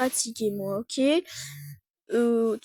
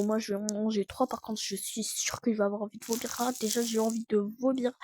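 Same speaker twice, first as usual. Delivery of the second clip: ordinary delivery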